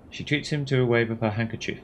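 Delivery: usual speaking voice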